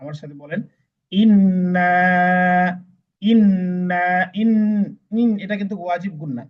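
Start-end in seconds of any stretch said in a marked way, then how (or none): 1.1-4.0 louder ইন্না, nasal n held and last vowel stretched; long drawn-out madd. Shown as a demonstration. Teacher leading learners to repeat, long drawn-out ইন্না. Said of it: "একটু বেশি লম্বা করে পড়তে হবে". 4.4-5.3 ইন with the n hummed in the nose; nasal ghunnah. Shown as a demonstration. Teacher explaining ghunnah to learners, nasal humming ইন. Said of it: "নাকের ভিতর নিয়ে পড়তে হবে".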